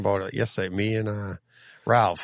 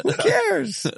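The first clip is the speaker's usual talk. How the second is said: squeaky, much higher dismissive exclaim; high carefree cry. Real, not acted. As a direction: toss it off high and gleeful. Laughing dismissal of the complaint.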